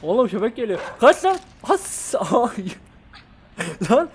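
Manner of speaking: high, loud panicked shout; panicked yelling. Real, not acted. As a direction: yell in sudden panic as the dog comes. Dog chasing him in game.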